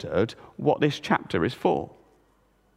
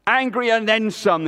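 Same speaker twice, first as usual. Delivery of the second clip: higher, sulky retort; angry reply; 6 semitones higher, 3 dB louder than usual. A narrator's reading. Jonah answering God, sulky and angry. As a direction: snap back, still sore. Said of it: "he replied".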